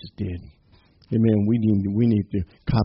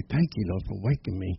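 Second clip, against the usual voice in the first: much softer, gentle thanks to the Lord; soft prayer; 4 semitones lower, 9 dB quieter than usual. Shown as a demonstration. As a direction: murmur a morning thank-you prayer. Modelling a morning prayer.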